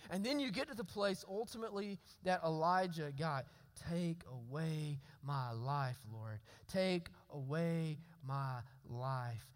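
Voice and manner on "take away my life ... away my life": slow, soft, lower, drained voice; barely able to ask, each word heavy; hopeless plea. Acted. Elijah begging God to take his life, slow and quiet.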